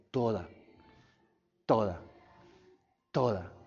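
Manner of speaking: toda repeated low and soft, falling each time; solemn, near-whispered repetition; hushed emphasis. Real, not acted. Preacher stresses Christ's sacrifice.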